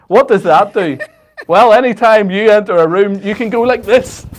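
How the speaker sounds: loud infomercial pitch; mock ad read. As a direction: push the offer like a TV salesman. Continuing fake ad read.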